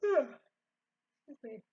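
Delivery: slow yawn through the words. Real, not acted. Tired reader apologizing mid-yawn. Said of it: "Pardon me if I'm yawning a lot".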